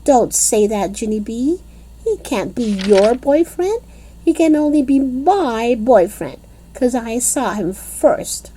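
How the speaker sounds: high, possessive scolding; a touch of jealous huff. A narrator's reading. Lucille telling Junie B he's her boyfriend, huffy. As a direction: stake your claim on him.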